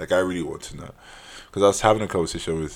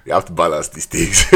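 loud, laughing delivery; amused and much louder; 3 semitones higher, 11 dB louder than usual. Real, not acted. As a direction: say it through a burst of laughter. Laughing at own joke.

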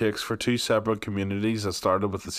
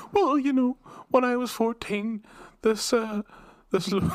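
high-pitched mock interviewee, hammed-up; mock guest voice; 13 semitones higher than usual. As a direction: squeak it out like a gushing guest. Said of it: "Watch your words".

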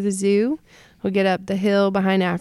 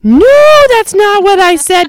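much higher and louder, flustered; panicked loud denial; 12 semitones higher, 13 dB louder than usual. Retold or imitated. Teacher loudly correcting the class after a child's misheard word.

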